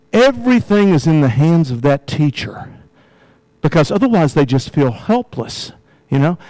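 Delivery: ordinary talk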